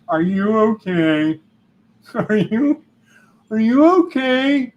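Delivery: hammed-up pushy "are you okay", rising and rising; mock tactless concern. Mocking tactless concerned questioning.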